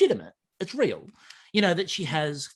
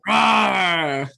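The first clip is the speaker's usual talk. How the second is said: loud roaring dragon shout; huge fiery dragon roar; 5 semitones higher, 8 dB louder than usual. Pretend. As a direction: roar out like a fire-breathing dragon. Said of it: "That's some dragon noise right there".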